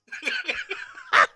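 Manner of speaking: sudden high laugh. Real, not acted. Player telling the host about the slap, laughing high. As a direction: let a high laugh burst out.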